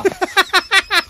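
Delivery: loud amused laugh; burst of laughter. Real, not acted. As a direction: laugh out at the line. Laughs at filmy dialogue.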